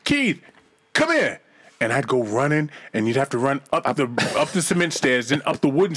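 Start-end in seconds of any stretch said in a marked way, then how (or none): 0.0-1.4 high calling yell up to the street; mother calling her son from the third floor; yelling out the window. Retold or imitated. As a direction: call the boy home from the window. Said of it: "she would yell out the window".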